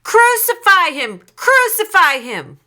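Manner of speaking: loud, very high mob chant; insistent high yells; shouting repeatedly. A narrator's reading. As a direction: keep shouting it like a relentless crowd. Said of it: "But they kept shouting".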